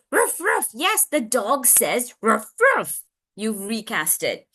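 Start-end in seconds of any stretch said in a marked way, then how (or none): 0.1-3.0 parent recasting. Acted. Parent echoing child's dog sound, animated recast. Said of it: "or you can recast where you go".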